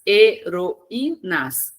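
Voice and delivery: "Heroínas" very slowly, split He-ro-í-nas; syllable by syllable. Shown as a demonstration. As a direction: separate each syllable of "Heroínas" clearly. Teacher splits word into syllables. ("Heroínas" silabado devagar, He-ro-í-nas)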